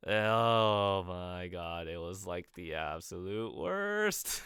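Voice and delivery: slow, drawn-out exasperation; exasperated. Real, not acted. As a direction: stretch out the words in dismay. Recalling a torturous game mechanic.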